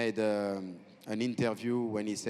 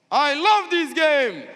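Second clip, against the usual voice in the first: huge, high, slow roar of the line; stretched-out hype yell; catchphrase shout; 15 dB louder, slower than usual. Acted. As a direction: belt it out to rouse the crowd. Crowd-rousing catchphrase before cheering.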